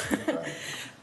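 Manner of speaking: short breathy nervous laugh. Real, not acted. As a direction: laugh off the nerves. Nervous laugh mid-speech.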